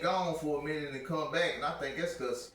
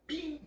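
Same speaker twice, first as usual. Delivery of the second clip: high-pitched electronic ding; imitated beep; 13 semitones higher, 6 dB quieter than usual. Storyteller mimics the tablet's search bar, short high beep. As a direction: pop it out high like a device chime.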